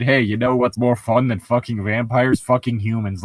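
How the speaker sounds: usual speaking voice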